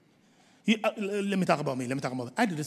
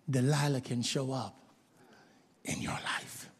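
ominous whisper; 9 semitones lower, 12 dB quieter than usual. Acted. Preacher warning the flock of temptation, whispered. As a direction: drop to a whisper on in your life. Dramatic warning about Delilah.

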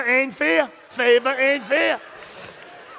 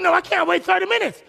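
high, loud whine; mock demanding shout; 8 semitones higher, 4 dB louder than usual. Pastor voices the entitled complaint, high shouted. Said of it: "All of a sudden".

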